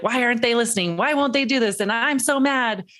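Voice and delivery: imitating a fed-up parent; frustrated parent. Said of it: "we're like".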